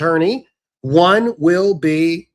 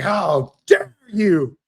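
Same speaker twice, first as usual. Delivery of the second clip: mock offended oldster; 2 semitones higher, much slower than usual. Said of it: "Social Security age getting pissed".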